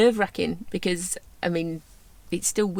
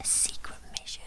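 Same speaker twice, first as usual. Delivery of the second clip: whisper; 13 dB quieter than usual. Pretend. Echoing secret mission whisper.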